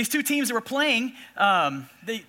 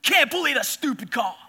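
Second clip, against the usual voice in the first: angry shout; 6 semitones higher, 3 dB louder than usual. Retold or imitated. Coach yelling from the box, furious shout. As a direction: bellow it at the umpire. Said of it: "he goes".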